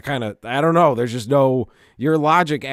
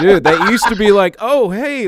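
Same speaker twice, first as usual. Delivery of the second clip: laughing through it; 8 semitones higher, 10 dB louder than usual. Real, not acted. Laughing, imitating old cheerful text.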